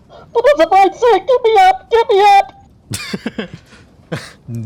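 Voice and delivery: high, loud muffled panicked yell. Acted. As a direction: shout for help through a diving helmet. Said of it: "sort of in a very muffled voice".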